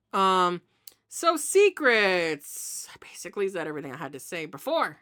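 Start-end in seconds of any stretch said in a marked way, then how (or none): 2.9-5.0 whisper. Real, not acted. Confiding secrets to the audience.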